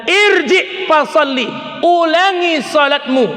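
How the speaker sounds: drawn-out firm order to pray again; stern Prophet. Retold or imitated. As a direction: Prophet ordering the man a third time. Prophet orders third time.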